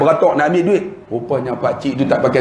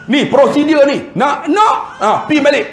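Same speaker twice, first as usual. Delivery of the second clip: mock rude clerk; 10 semitones higher than usual. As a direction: sneer at the old man's excuse. Kerani terus menghalau. (kerani marah tolak kad pakcik, nada sinis)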